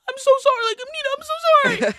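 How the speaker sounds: put-on high, loud pleading toddler; sorry little boy. Nephew apologises to his cousin for the spilled chips, pleading. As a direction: plead like a scared child. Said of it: "But he was like".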